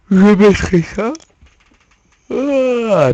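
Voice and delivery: parler en plein bâillement. Real, not acted. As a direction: mots étirés et aigus dans un bâillement. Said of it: "je baille trop". (en bâillant à moitié)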